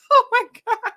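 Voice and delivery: high, loud laughing gasp of a reaction; laughing disbelief. Retold or imitated. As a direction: retell your shock through laughter. Retelling her reaction to the live.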